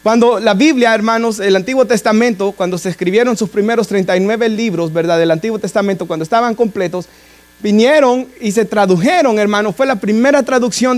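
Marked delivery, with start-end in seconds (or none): none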